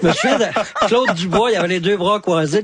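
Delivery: normal talking voice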